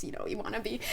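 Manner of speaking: normal talking voice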